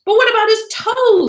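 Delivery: high, loud puzzled cry; loud bewildered question. Acted. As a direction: blurt it out, baffled. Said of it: "dreadfully puzzled".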